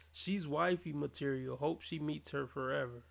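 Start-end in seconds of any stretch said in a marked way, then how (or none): none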